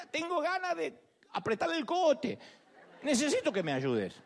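desahogo agudo, medio en broma; enojo confesado. Acted. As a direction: suelta el enojo con voz más aguda. Imita una confesión sincera de enojo. (enojo actuado, en tono alto)

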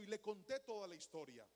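ordinary delivery

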